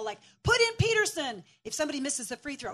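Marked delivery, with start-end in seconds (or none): 0.4-1.4 higher, louder mock yell at the screen; sports fan heckling the TV, higher and louder. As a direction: imitate a sports fan barking at the players. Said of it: "he'll yell".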